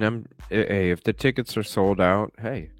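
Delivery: ordinary talk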